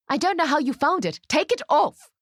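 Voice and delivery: high, heated outburst building to a command; fuming. A narrator's reading. Ruth demands the dress come off, fuming. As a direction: let anger climb into a sharp order. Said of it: "she fumes".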